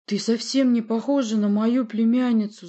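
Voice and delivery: high, loud angry denial; shouting. A narrator's reading. Prince yells at the woman claiming kinship, shouting. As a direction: shout the accusation at her. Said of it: "закричал Порти".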